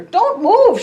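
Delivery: sharp high cry to the reindeer; a high, loud call to stop them; cried out. Acted. Tika crying out to the scattering reindeer, loud and high. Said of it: "she cried".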